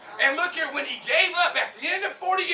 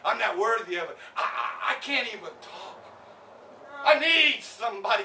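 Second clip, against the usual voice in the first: normal talking voice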